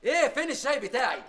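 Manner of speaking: loud, high yell for the tea; fed-up shout; exasperated yell. Acted. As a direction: bark it out impatiently. Boss yelling for tea.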